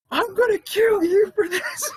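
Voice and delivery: very high, slow, laughing-cry threat; mock threat, laugh-crying. The girl threatening him happily, high laugh-crying. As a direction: squeal it through tears of laughter.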